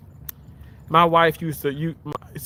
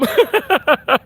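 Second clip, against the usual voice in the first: high loud laugh; 13 semitones higher, 6 dB louder than usual. Real, not acted. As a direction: burst of high laughter, much louder. Laughing about pink scrubs.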